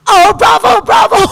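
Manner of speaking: shrieking cheer. Acted. Children cheer wildly.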